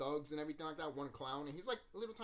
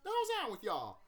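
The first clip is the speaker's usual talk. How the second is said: high, fast, louder outraged protest; 12 semitones higher, 5 dB louder, faster than usual. Retold or imitated. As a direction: fire it off high and fast, indignant. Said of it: "you're like".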